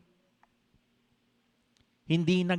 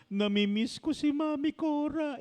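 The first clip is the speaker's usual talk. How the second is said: much higher, whiny imitation of the crying wife; imitating crying wife; 11 semitones higher than usual. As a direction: mimic the wife's tearful complaint, pitched high. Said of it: "Iyak siya ng iyak". (ginagaya ang umiiyak na asawa, mataas na boses)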